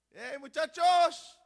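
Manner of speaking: loud, slow hail; drawn-out shouted greeting; calling out. Acted. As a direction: holler to a group of lads. Preacher acts out the hail.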